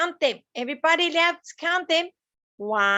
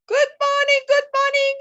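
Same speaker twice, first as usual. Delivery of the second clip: higher, louder chirpy duck voice; be a cheery little duck calling hello; a perky duck greeting the class, higher and louder; 11 semitones higher, 4 dB louder, faster than usual. Acted.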